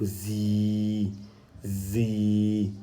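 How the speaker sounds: "zi" with the i held long; long vowel. Shown as a demonstration. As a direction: stretch each "zi" on an even note. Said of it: "sert d'outil d'allongement à la voyelle I".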